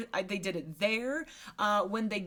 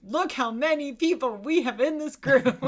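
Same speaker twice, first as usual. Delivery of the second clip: mock showy boast; 5 semitones higher, 7 dB louder than usual. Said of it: "to demonstrate".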